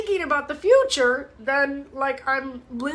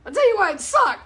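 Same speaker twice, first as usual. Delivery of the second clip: sharp higher, louder retort; a scoffing no, then a flat it sucked; retold retort; 7 semitones higher, 4 dB louder than usual. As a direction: fire it back, loud and high. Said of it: "I'm like".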